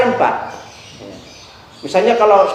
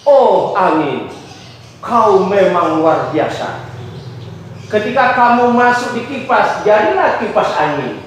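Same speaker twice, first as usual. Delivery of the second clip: poem recital. Shown as a demonstration. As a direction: recite it dramatically, drawing out each line. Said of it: "saya membacakan puisi judulnya Angin".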